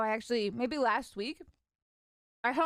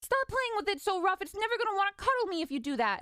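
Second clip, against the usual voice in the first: high, fast fretting mom voice, pitched way up; 9 semitones higher than usual. Retold or imitated. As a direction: squeal at him to stop roughhousing with the kitten. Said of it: "my mom will freak out like".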